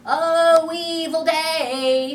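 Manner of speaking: loud, slow sung wail; pirate mourning the news; wailing Starkey. Acted. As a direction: howl it like a dirge. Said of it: "said Starkey".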